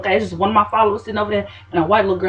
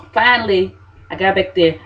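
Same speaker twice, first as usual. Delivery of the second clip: usual speaking voice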